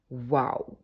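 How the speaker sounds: gasp of awe. Real, not acted. Still reeling from the card.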